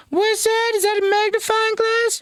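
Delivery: very high cartoon voice; squeaky high voice. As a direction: squeak it out in falsetto. Jokey high-pitched voice.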